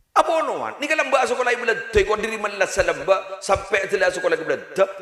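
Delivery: high, fast, laughing boss voice; excited retold boss. Preacher imitating the boss describing his company, high and fast. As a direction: rattle it off like a proud boss.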